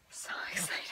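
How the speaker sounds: soft breathy whisper. Real, not acted. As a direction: whisper it to yourself. Whispered, then said aloud.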